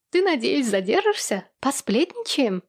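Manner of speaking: high, coaxing gossip's voice; playful smirk in the voice; smirking invitation. A narrator's reading. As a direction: tempt a friend to stay for gossip. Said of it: "продолжала ухмыляться она".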